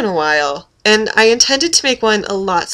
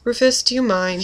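normal talking voice

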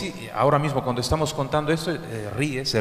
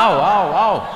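loud fake cries of pain; mock screaming; 3 semitones higher, 6 dB louder, much slower than usual. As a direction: echo a boy faking a beating. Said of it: "estaba sonriendo y gritaba".

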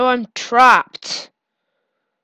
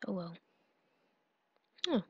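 whisper; 6 semitones lower, 18 dB quieter, much faster than usual. Real, not acted. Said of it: "Oh well (first take)".

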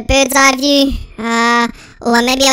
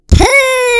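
disgusted squeal; 9 semitones higher, 5 dB louder than usual. Real, not acted. Reacting to sewer smell.

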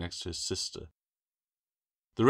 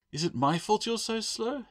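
teasing; 3 dB louder than usual. Acted. Teasing brother.

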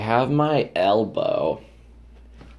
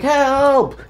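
high yelled help; mock cry for help; 5 dB louder than usual. Yelling help at strangers to get money. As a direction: shout help like an emergency.

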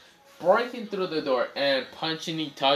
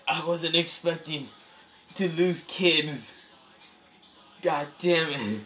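pained high whine; 5 semitones higher, slower than usual. Acted. Itachi wincing, half laughing.

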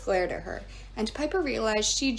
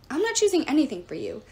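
louder, higher refusal; defiant shout; 5 semitones higher, 4 dB louder, faster than usual. A narrator's reading. Piper refuses Gaia's choice, shouting. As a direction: refuse her flat out. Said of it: "she shouted".

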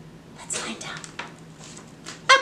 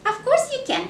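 higher playful reply; 10 semitones higher than usual. Acted. To a little puppet friend, a much higher cheerful reply. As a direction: lift it up brightly, much higher.

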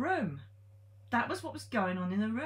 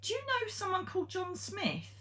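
high raised call down the stairs; 11 semitones higher than usual. Retold or imitated. Calling down the stairs to the woman, a high raised call. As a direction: call down to her from upstairs. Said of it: "I shouted down to the woman".

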